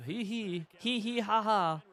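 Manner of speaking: high, slow gleeful "hee hee ha ha"; playful taunting giggle; mock cackle. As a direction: gloat with a hammed-up little laugh.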